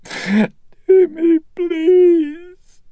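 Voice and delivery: high, loud crying plea; weeping plea. A narrator's reading. Mother weeping and begging her son. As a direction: sob his name out. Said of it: "the sound of weeping".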